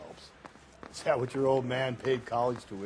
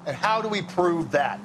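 pointed challenge, louder and higher; challenging; 6 semitones higher, 5 dB louder than usual. Acted. Partner challenging Phelps's theory about the killer, raised and pointed. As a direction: push back hard on his theory.

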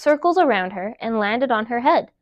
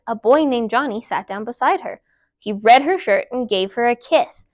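usual speaking voice

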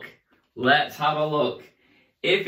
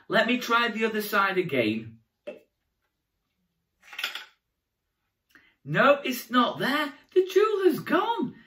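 higher, hammed-up dismay; mock surprise; 6 semitones higher than usual. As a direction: act shocked for the kids.

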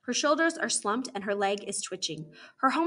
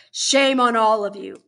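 loud, forceful reproach; angry, punched condemnation; stern shaming; 11 dB louder than usual. Acted. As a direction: turn the rebuke loudly on the whole group. Warden shames all the children.

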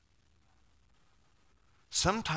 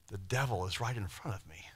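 hushed dread; 2 semitones lower, 7 dB quieter than usual. Acted. Sermon, sudden dread.

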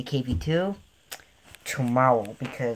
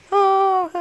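a squeaky, much higher "Oh" of disbelief; shocked gasp; 6 dB louder than usual. Real, not acted. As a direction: jump way up in pitch, caught off guard. Stunned at spent credits.

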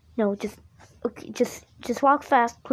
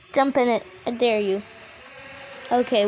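ordinary talk

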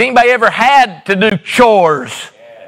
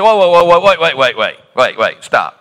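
mock alarmed interruption; 4 semitones lower than usual. Pastor interrupting the wife.

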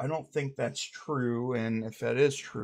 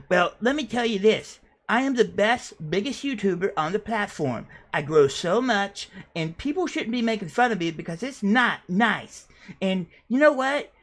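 much higher, louder, whiny boasting voice; Sweetie character voice; 8 semitones higher, 4 dB louder than usual. Acted. Sweetie defending himself to the interviewer, high and whiny. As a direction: puff yourself up while sounding hurt.

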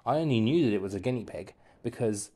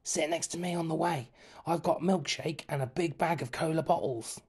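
put-on higher friendly kid voice; boyish voice; 5 semitones higher than usual. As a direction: speak like an eager schoolboy. Kevin's voice in story.

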